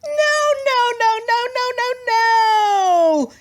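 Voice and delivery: very high, loud shrieking no; screeching tantrum. Acted. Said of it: "screeched Lulu".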